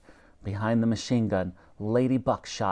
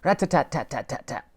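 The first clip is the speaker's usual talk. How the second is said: gunfire rattle; 2 semitones higher than usual. A narrator's reading. Machine gun fires.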